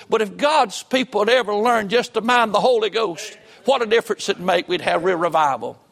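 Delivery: full-force sermon exhortation; impassioned preaching. Real, not acted. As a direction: press the plea home from the pulpit.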